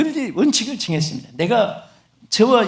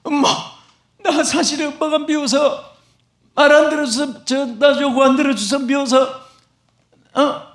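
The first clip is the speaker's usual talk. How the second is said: put-on child complaining; 6 semitones higher than usual. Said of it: "하고 소리쳤더니".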